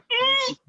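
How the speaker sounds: high effortful grunt; straining grunt. Retold or imitated. Pulling her up into the house, straining grunt. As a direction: imitate heaving something heavy. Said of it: "I'm making all, all this noise".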